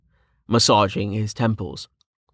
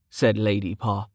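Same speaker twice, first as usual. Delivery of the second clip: ordinary delivery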